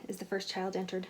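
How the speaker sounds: usual speaking voice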